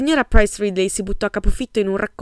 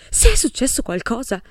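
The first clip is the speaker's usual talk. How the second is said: louder, slightly higher dramatic echo of the question; dramatic repeat; 5 semitones higher, 4 dB louder than usual. A narrator's reading. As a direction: throw the question back with theatrical weight. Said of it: "ripeté la domanda in modo drammatico".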